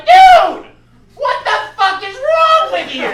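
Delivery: very high, loud yell of disbelief; losing it. Retold or imitated. Storyteller confronting the rude customer in line, outraged yelling. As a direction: explode at him, voice shooting up. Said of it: "I fucking lost it".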